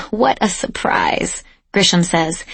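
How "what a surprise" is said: dry, slow sarcastic remark. Acted. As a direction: let the sarcasm drip slowly. Sarcastic reply about commander.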